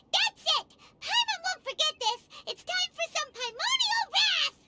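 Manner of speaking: high-pitched comic fury; indignant outburst. Acted. Laughed-at companion vows wrath, high indignant outburst. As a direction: burst out in high, squeaky indignation.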